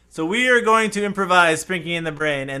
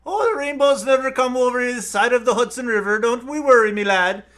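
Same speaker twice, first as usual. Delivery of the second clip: higher-pitched Irish leprechaun brogue; Irish accent; 6 semitones higher than usual. Acted. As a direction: reassure a lad in a hammed-up Irish accent. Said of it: "do the accent that I can't do".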